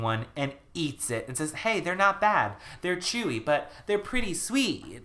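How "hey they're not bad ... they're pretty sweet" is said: pleasantly surprised. Retold or imitated. Ricky tastes a cookie.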